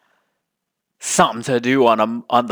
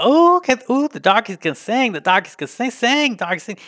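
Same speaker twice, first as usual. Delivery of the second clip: high, fawning mock-admiring voice, pitched way up; 10 semitones higher than usual. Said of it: "And it's like".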